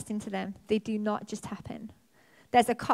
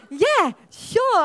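much higher, louder flustered yes; a flustered startled agreement; surprised yes; 11 semitones higher, 9 dB louder than usual. Retold or imitated. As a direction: caught off guard, blurt it out. Startled reply to neighbour's list.

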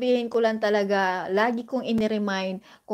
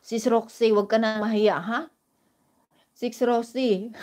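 normal talking voice

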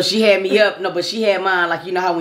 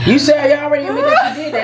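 shouting through laughter; cracking up while yelling it; laughing; 8 semitones higher, 3 dB louder than usual. Real, not acted. Laughing accusation in banter.

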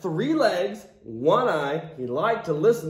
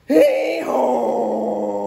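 donkey bray. Acted. Donkey bray in read-aloud.

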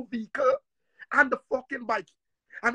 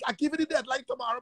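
ordinary delivery

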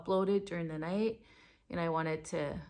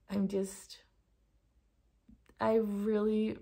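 ordinary talk

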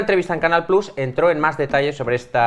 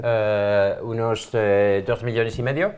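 put-on French accent; much slower than usual. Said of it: "yo intentaré poner acento francés".

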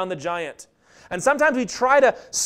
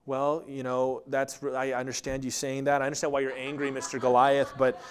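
softer, overly polite and apologetic; mock polite; 8 semitones lower, 6 dB quieter than usual. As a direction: play the timid, courteous David, gently. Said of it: "was not to say".

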